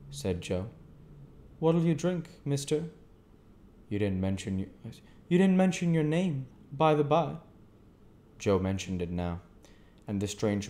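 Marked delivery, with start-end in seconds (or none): 1.6-7.4 higher, louder stranger's voice. A narrator's reading. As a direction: voice the odd stranger, curious. The stranger questions Joe.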